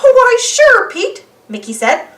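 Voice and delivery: high, loud with a laugh; friendly mouse inviting; cheerful Mickey. Acted. Mickey welcoming Pete.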